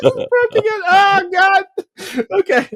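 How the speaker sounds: high breaking-up laugh through words; can't hold it together. Real, not acted. Host cracking up mid story.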